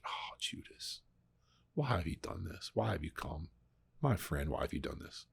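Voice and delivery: hushed, heartbroken whisper to a friend. Retold or imitated. Jesus speaking to Judas in the garden, heartbroken whisper. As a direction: breathe it out softly, grieving. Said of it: "he's heartbroken for Judas".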